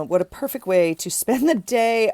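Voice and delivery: sarcastic, sing-song read of the quote; snarky. Shown as a demonstration. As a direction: read it like you don't buy it one bit. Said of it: "Snarky quote".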